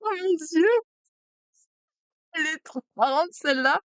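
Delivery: high, excited oh my god; delighted surprise. Real, not acted. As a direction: gasp of joy at the card. Delighted at a new card.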